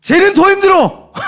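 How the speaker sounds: boxing coach shouting between rounds; coach's shout. Retold or imitated. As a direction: bark encouragement at a worn-out fighter. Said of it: "그때 하는 말이 이렇게 들리는 거예요".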